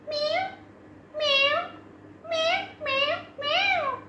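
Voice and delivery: high little meows, rising; squeaky kitten meowing. Pretend. Cat sounds in a kids' song.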